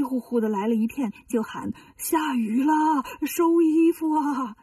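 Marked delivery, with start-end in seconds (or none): 2.0-4.6 slow, drawn-out call to the others. Acted. Tang Seng calls to his disciples about rain, slow drawn-out call. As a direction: stretch the words out like hollering across a yard.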